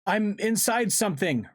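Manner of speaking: high, distressed call; alarmed cry. Acted. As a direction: cry out while trapped inside. Swallowed friend calls in distress.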